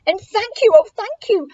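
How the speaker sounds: high, gushing thanks; high-pitched, effusive gratitude; grateful cry. Acted. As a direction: gush with relief as the rescued witch. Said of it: "the grateful witch cried".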